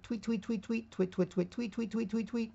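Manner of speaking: high chirpy bird noises; mocking Twitter with high birdlike tweets; bird tweeting. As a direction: chirp the words like a little bird.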